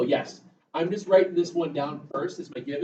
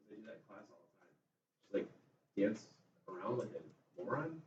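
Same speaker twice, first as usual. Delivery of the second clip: whispered aside to wife; 7 semitones lower, 10 dB quieter than usual. Retold or imitated. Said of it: "I'm like".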